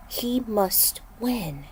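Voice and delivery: breathy, slow whisper of a prophecy; slow eerie whisper. Acted. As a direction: breathe the words out like a secret. Repeating visions' words in trance.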